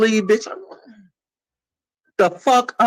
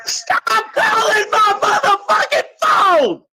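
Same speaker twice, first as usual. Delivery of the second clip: furious crying shout; slower than usual. Real, not acted. Fed up with friends calling her phone, a crying furious scream. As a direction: scream it through tears.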